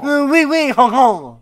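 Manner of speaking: hammy French laugh, high; bad French accent. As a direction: cackle in a fake French accent. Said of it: "that bad at French accents".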